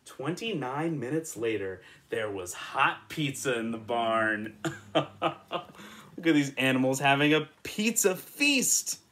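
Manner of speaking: ordinary delivery, nothing marked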